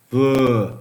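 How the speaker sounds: blurts the name out loudly; loud mocking shout. Real, not acted. Repeats the name, much louder.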